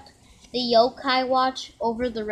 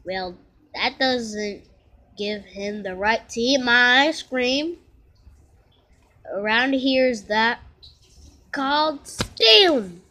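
stern scolding; 7 dB louder than usual. Acted. Nate scolds Komasan for eating his ice cream, louder and building. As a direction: lay down the law to a thief. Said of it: "Nate glared".

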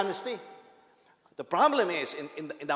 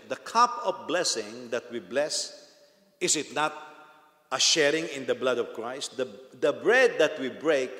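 louder, measured Bible reading; scripture reading; 6 dB louder than usual. Acted. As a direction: read the verses out to the congregation. Reads scripture aloud.

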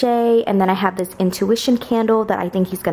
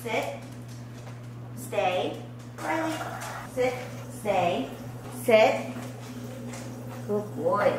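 firm dog commands; 2 semitones higher, 8 dB quieter, slower than usual. Real, not acted. Training dogs to sit and stay.

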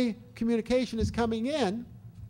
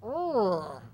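put-on annoyed baby grunt. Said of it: "begins going".

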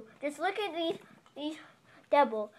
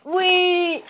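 gleeful wee; 4 semitones higher, 12 dB louder than usual. Pretend. Train falling.

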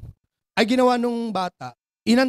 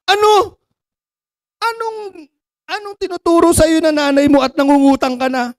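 higher, louder stern rebuke; scolding; 4 semitones higher, 7 dB louder than usual. Acted. Father scolding son for asking to borrow money, loud stern rebuke. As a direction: scold the child hard.

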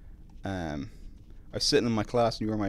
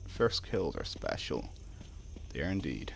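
ordinary talk

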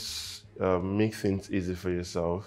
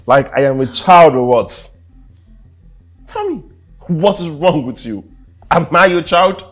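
loud, rising indignant shouts; angry outburst; 2 semitones higher, 8 dB louder than usual. Acted. Husband rejecting wife's questions, angry outburst. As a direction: demand she stop treating him like a child.

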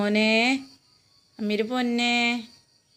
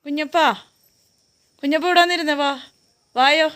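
coaxing call; 6 semitones higher, 3 dB louder than usual. Real, not acted. Calling the dog over.

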